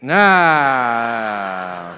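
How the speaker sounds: normal talking voice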